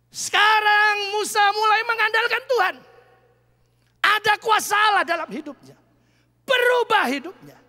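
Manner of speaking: high, loud preaching shout; rising sermon climax, shouted; full-force preaching. Acted. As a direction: proclaim it to the whole church.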